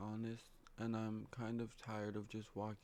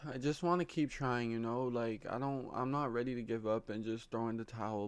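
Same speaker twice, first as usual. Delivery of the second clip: ordinary delivery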